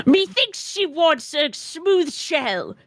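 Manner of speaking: slow, rugged sailor voice; old-timey pirate. Acted. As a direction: play the cursed old sailor. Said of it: "Oh, we're just committing to this".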